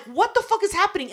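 shocked and high; high outraged cry. Retold or imitated. Telling a friend about the stall, high outraged cry. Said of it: "And I'm like".

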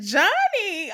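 shocked. Retold or imitated. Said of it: "I said".

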